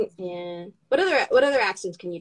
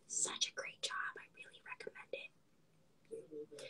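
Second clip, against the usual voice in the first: barely audible whispered plug; whispered aside; 22 dB quieter, slower than usual. Real, not acted. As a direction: whisper your recommendation.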